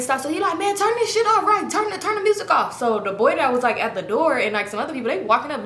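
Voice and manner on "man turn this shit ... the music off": much higher, insistent call to cut music; demanding yell. Retold or imitated. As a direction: bark at the DJ to kill it. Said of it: "He trying to get the music stopped".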